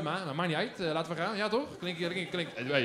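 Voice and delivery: usual speaking voice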